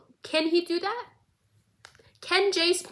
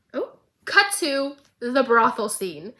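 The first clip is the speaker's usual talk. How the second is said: ordinary delivery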